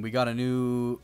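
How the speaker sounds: ordinary talk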